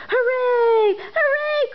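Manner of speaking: very high, loud cheer; cheering hooray. Acted. Clown and toys cheering at the top, joyful. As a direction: shout for joy. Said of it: "cried the funny little clown".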